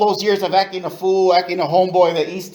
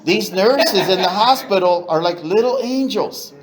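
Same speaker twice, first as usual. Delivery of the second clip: laughing; 4 semitones higher than usual. Real, not acted. Laughing at his own impression.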